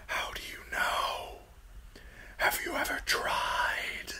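soft, thin whisper; whispered, eerie challenge; high thin crow voice. A narrator's reading. Crow questioning the falling boy, high thin whisper. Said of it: "The voice was high and thin".

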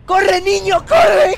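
loud, high panicked yell; urgent shout. Real, not acted. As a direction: yell at yourself to run. Running from the dogs.

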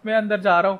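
ordinary delivery

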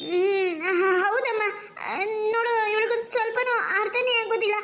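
crying while she complains; tearful bride. Acted. Bride crying to her mother about her friend, tearful. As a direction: whimper through the words.

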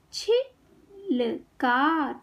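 छिलका very slowly in three separate parts; syllable by syllable. Shown as a demonstration. Teacher teaching kids to read, syllables apart. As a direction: break छिलका into छि - ल - का. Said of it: "शब्द पढ़ना सिखाऊंगी".

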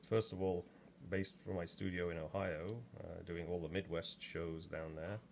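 normal talking voice

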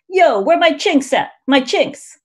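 high, loud brash street call; Ronny Chieng bit. Retold or imitated. Comedian mocking a slur-owning bravado, high loud brash call. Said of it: "Mister Chieng jokes".

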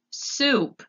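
shouted single word, pitched high. A narrator's reading. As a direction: bark it out loud across to a poor listener. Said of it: "shouted Gregory".

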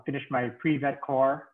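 usual speaking voice

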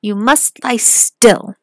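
loud, slow command; exclaimed. A narrator's reading. Robber girl ordering Gerda to lie still, loud and slow. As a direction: bark it out slowly and loudly. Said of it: "exclaimed the little robber girl".

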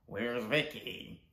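normal talking voice

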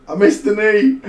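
I missed the knee yelled high and loud; loud high exclaimed I missed the knee; frustrated shout. Real, not acted. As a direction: bellow it in frustration. Exclaims same words.

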